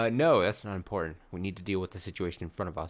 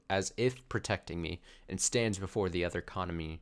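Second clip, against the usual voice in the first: ordinary talk, nothing marked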